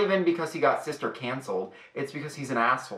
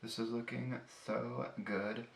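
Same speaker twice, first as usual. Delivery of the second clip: much softer, half to yourself; absorbed murmur; 13 dB quieter than usual. Real, not acted. Vlogger pleased with the look while blending, murmured. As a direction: murmur it quietly while busy working.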